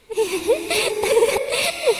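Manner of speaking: soft, high ghostly laugh in the dark; a quiet spooky witch-like laugh; eerie laugh. Acted. (अँधेरे में धीमी डरावनी हँसी)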